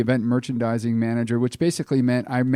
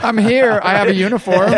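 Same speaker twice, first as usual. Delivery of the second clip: playful; 8 semitones higher, 7 dB louder than usual. Pretend. Playful mock reply, laughing.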